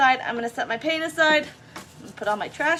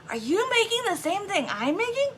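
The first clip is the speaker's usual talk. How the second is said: put-on older lady; 8 semitones higher than usual. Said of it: "she goes".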